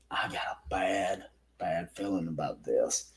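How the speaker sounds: whispered, soft and breathless; hushed warning. Retold or imitated. Holly whispering her bad feeling to the narrator.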